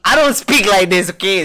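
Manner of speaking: high, loud mock-indignant protest; mock shouting. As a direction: shout back as if imitating a loud talker. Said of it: "your normal talking voice is like shouting".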